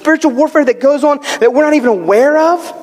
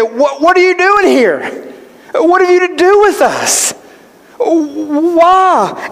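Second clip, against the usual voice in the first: higher, drawn-out protest; alarmed demons' protest; 5 semitones higher, slower than usual. Acted. Preacher playing demons startled by Jesus, alarmed protest. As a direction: protest his arrival, pitched up.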